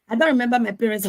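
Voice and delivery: normal talking voice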